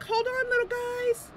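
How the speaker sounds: very high, coaxing character voice; Losa. Acted. Losa reassuring crabs before teleporting, high voice. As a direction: call to small creatures in a high voice. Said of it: "she's like".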